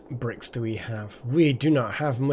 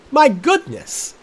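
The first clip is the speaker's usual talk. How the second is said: "My goodness!" cried high, loud and slow; "My goodness!" stretched in hammed-up shock; mock outrage; 12 semitones higher, 7 dB louder, slower than usual. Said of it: "repeated with rising outrage".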